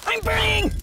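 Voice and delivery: high, hammed-up burning cry; cry of agony. Game character on fire, high cry of agony.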